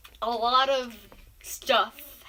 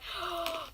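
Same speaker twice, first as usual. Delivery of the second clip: gasp; 4 semitones higher, 8 dB quieter than usual. Retold or imitated. Chair squeaks in the lesson.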